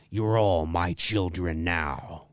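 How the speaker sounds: low, slow hint of growl; slightly deepened Freddy. Shown as a demonstration. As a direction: lean the voice down a little. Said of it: "I might say it like".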